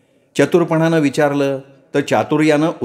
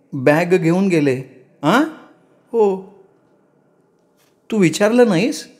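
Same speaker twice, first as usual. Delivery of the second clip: put-on distressed young wife; 7 semitones higher than usual. A narrator's reading. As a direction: answer as a bewildered young bride near tears. Young wife and mother-in-law exchange.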